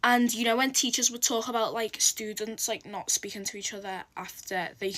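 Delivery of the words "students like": normal talking voice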